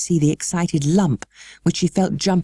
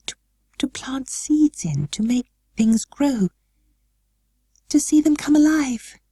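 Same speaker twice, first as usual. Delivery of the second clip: halting, half-whispered, then rising higher; faltering; 10 semitones higher than usual. A narrator's reading. Mary explaining to her guardian, faltering then eager. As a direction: stumble then find courage. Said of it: "Mary faltered".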